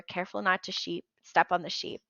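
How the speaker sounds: ordinary delivery, nothing marked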